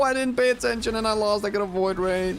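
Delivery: real frustrated outburst; agitated lament. Real, not acted. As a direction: blurt it out still reeling. Right after losing.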